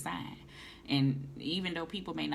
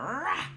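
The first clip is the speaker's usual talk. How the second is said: high, loud mock shout; mock startled shout; 11 semitones higher, 5 dB louder than usual. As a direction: bark out a startled rah. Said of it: "I was like".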